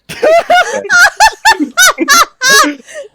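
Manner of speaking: high hysterical cackle; shrieking laugh. Real, not acted. Table loses it laughing.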